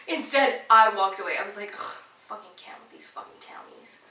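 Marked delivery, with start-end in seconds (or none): 1.7-4.0 whispered grumble, much softer; under-breath whisper. Retold or imitated. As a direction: hiss the insult so she can't hear. Muttering insult under her breath.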